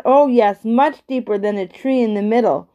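plain reading voice

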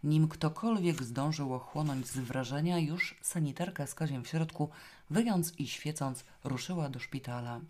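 ordinary delivery, nothing marked

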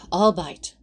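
a resigned sigh before the words. Acted. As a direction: sigh it out, giving in. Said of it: "Aziraphale sighed".

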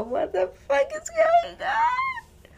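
high, drawn-out yell; exasperated yell. Real, not acted. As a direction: cry out in disbelief. Exasperated outburst at the clip.

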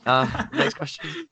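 very high, slow, put-on announcer voice; mock announcer. Host jokingly announces the next question, squeaky and drawn out. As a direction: stretch it out like a squeaky game-show host.

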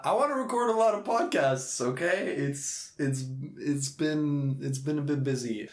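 high, protesting mock excuse; mock defensive. Podcaster excusing his planned-out dates, mock defensive. Playful excuse, then admits kidding.

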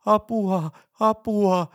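high-pitched cry for help; avunhuuto. A narrator's reading. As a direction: shout it as if yelling at full throat, high and loud. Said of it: "alkaa huutaa kurkku suorana". (huuda apua korkealla äänellä)

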